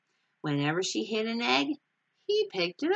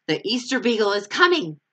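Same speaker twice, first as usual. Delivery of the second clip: louder, thrilled call to everyone; excited announcement; 4 semitones higher, 8 dB louder than usual. Acted. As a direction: shout the big news to the kids. Linus announces the Beagle.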